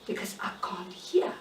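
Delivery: soft unvoiced whisper. Shown as a demonstration. Explaining why she could not hear, still whispering. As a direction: whisper it, barely there.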